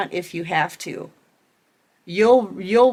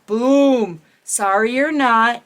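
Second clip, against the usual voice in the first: emphatic boom; 2 semitones higher, 5 dB louder, slower than usual. Real, not acted. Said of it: "emphatic closing".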